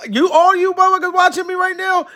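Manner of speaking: high-pitched incredulous question; amazed disbelief. Real, not acted. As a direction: blurt it out high, astonished at the crowd. Amazed at where viewers are from.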